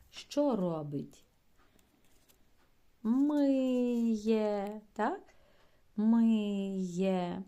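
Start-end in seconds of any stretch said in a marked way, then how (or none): 3.0-4.8 Миє called out loud, the ending є stressed; teacher sounding out Миє; loud model word. Shown as a demonstration. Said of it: "Після, е, голосної «и» теж два звуки". 6.0-7.4 Миє called out loud, the ending є stressed; teacher sounding out Миє; loud model word. Shown as a demonstration. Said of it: "Після, е, голосної «и» теж два звуки".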